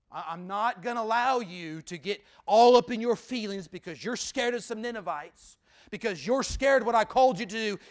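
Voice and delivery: God firmly rebuking Jonah. Acted. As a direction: scold Jonah with love. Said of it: "what the storm spoke loudly to Jonah from God".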